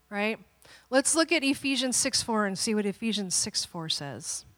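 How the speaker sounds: normal talking voice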